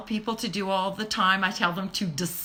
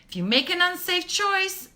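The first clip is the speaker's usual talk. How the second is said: ordinary delivery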